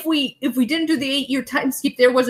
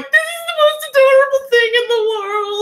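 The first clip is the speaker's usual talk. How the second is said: very high squealed adoration; gushing over cuteness; 13 semitones higher than usual. Retold or imitated. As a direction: squeal it as if melting over the kids. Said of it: "and I'm like".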